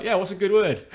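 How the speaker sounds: high, loud mock-Australian, laughing; Australian accent. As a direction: play the Australian, amused. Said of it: "the most Australian man".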